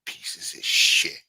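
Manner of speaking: slow hissed whisper; slow whispered curse at the saboteurs; whispered insult. Real, not acted. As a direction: spit it out under your breath. Muttered insult.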